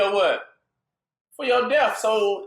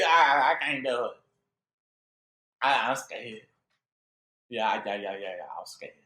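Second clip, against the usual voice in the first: softer and lower, whimpering and fading; mock scared; 9 semitones lower, 3 dB quieter than usual. Fearful people refusing to march, whimpering mock fear. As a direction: act out a cowering follower. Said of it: "And they".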